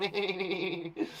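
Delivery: rapid, repeated chittering noise; quick imitated bird chatter; little birds chattering. Shown as a demonstration. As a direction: chatter like a flock of small birds. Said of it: "Little birds chatter".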